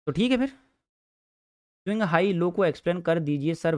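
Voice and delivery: lightly voiced student asking sir slowly; a hint of a student's polite request; mock student request. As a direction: play the pupil politely asking the teacher. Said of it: "voicing a student's request".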